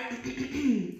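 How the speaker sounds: staged throat clearing; sheep clearing throat. Acted. Sheep clearing her throat before reciting. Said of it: "So the sheep cleared her throat".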